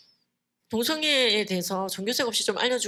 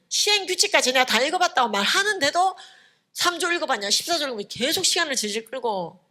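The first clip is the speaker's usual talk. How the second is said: louder, higher, heated complaint; indignant outburst; 6 semitones higher, 4 dB louder than usual. Real, not acted. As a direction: let the frustration boil over as you recount being interrupted. Indignant retelling of being cut off. (억울함에 목소리를 높인 격한 하소연)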